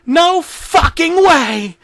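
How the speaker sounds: high, slow horrified shriek; horrified scream. A narrator's reading. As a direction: scream it in horror. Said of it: "I screamed in horror".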